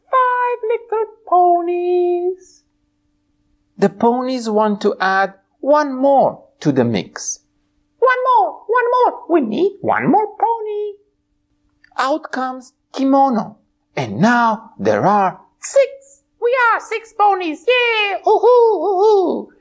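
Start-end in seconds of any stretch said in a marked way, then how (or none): 0.1-2.6 high bright pony voice; a cheerful high puppet pony. Acted. Twilight puppet answering host, high pony voice. 8.0-10.9 high bright pony voice; a cheerful high puppet pony. Acted. Twilight puppet answering host, high pony voice. 16.4-19.5 high squealing party cheer; excited pony cheer. Acted. Puppet pony cheering the party, high and excited.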